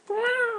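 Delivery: very high elephant call; high mock trumpeting; imitated elephant trumpet. Elephant answers to its name.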